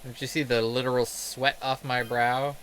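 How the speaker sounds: ordinary talk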